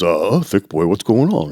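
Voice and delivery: lightly imitated baritone partner; a hint of a baritone voice. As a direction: put on a slight baritone, casually checking in. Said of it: "his baritone".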